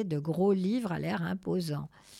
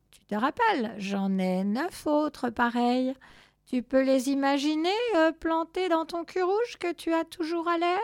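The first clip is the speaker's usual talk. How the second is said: lightly voiced menace, climbing higher; a cat's taunting warning; threatening cat; 8 semitones higher, 4 dB louder than usual.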